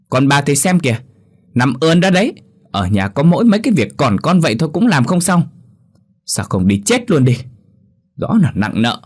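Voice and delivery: high, loud shrill cursing; furious tirade. Acted. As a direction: hurl abuse at the old woman, all fury. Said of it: "cứ thế mà chửi mẹ chồng".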